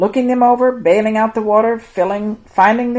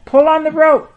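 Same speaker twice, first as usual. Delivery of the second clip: high, raised storybook shout; 7 semitones higher, 6 dB louder than usual. A narrator's reading. Peter to his crewmates hauling the net, shouting. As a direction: shout the order, high and loud. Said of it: "shouted Peter".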